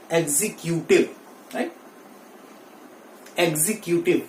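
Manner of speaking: 'executive' as eg-zi-kyu-tiv, stress later; wrong pronunciation. Shown as a demonstration. Teacher showing how people say it, eg-zi-kyu-tiv. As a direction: clear kyu in the middle of 'executive'. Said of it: "But that is wrong".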